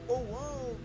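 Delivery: normal talking voice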